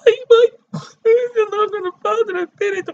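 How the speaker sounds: high, fast, shrieking mock panic; hammed-up panicked wail. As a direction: wail like someone losing it over a bill. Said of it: "Don't be like".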